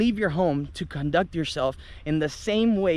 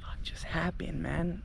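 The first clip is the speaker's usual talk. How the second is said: whispered, much softer, stunned; shocked whisper; 13 dB quieter than usual. Retold or imitated. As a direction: whisper it to the table in disbelief. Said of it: "we're all just looking at these people like".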